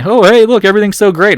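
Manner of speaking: high, loud mock delight; fake-happy gush, dripping sarcasm; sarcastic cheer. Trader mocking a cheerful market, high sarcastic gush.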